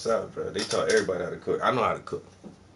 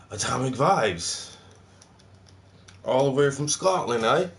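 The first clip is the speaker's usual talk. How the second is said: bad Scottish accent; 3 semitones higher than usual. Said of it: "that was so bad".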